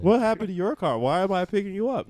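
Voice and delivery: high-pitched mock puzzlement; mock questioning. Showing a friend what he should have asked, mock puzzled. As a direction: act out baffled questions.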